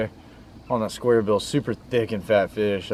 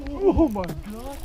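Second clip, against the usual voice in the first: shocked gasp; 14 semitones higher, slower than usual. Real, not acted. Big fish strikes, gasping.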